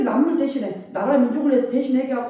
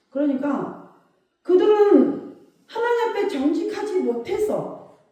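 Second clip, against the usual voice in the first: normal talking voice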